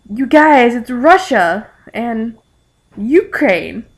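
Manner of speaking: loud, drawn-out mock-excited reveal; mock fangirl excitement. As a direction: announce it slowly with playful excitement. Acted excited recognition of fan characters.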